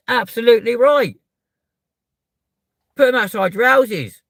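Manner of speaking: loud, high heated shouting; real angry ranting. Real, not acted. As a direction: keep hammering it, fed up. Ranting about pride flags.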